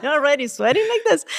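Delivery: amused disbelief bubbling over; laughing. Real, not acted. Telling the host about runners drenched before the start, laughing.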